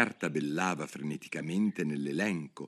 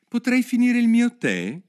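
vocina molto acuta e tesa del cappellaio; apprehensive, high-pitched plea; apprensivo; 8 dB louder than usual. A narrator's reading. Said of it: "con uno sguardo colmo d'apprensione". (supplica apprensiva con voce acuta)

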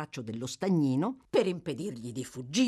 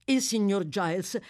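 usual speaking voice

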